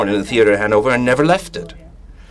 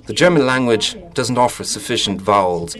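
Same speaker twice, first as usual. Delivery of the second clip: ordinary delivery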